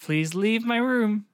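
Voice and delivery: mock wooden acting, word by word; flat film girlfriend. Co-host as girlfriend sending boyfriend out, stilted. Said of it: "that's exactly how they talk".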